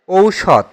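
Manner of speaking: ঔষধ said as "OU-shodh"; model pronunciation. Shown as a demonstration. Teacher gives pupils the answer for ঔষধ, model pronunciation with "ou". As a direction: open ঔষধ on a clear "ou" glide. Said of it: "ঔষধ শব্দের সঠিক উচ্চারণ হচ্ছে".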